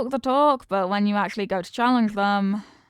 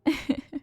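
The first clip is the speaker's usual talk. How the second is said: a brief, quieter laugh; soft chuckle; 5 semitones higher, 4 dB quieter than usual. Acted. Boss flirting with Angel, soft chuckle. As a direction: chuckle at her reaction.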